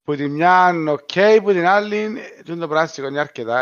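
ordinary talk, nothing marked

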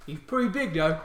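loud, high orc holler; excited orc. Acted. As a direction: call out to your mate as an orc guard. Orc calls mate over to see dog.

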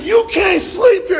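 retold angry yell at a homeless man; hostile. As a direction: snap it at him, fed up. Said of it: "And I got hostile".

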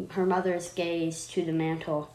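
ordinary delivery

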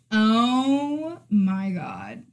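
slow, drawn-out oh my god; imitating friend. Imitating her friend's slow scandalized oh my God. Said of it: "when I tell you stuff".

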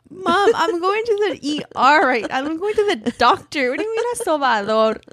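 high, incredulous arguing with Mom; retold exasperated protest. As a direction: push back at your mother in disbelief. Argues with Mom about the doctor.

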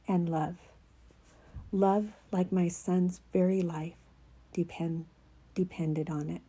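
hushed, half-whispered reading of a prophetic word; soft devotional reading. Acted. Reading her written word to a child of God, softly half-whispered. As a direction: read it softly as the Lord's voice, tender and unhurried.

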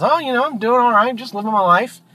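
high-pitched, easygoing duck; casual duck. Acted. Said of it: "The duck says".

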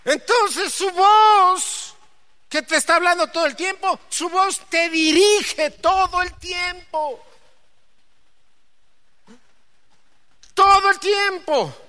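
high, loud, drawn-out preaching; preaching at full force. Real, not acted. Preacher proclaiming God's voice to the church, slow and loud. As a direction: proclaim it over the congregation.

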